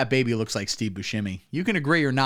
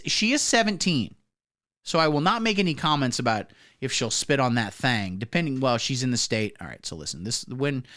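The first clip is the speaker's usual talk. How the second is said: ordinary delivery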